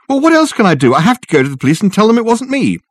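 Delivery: pleading in agitation; panicked protest. Acted.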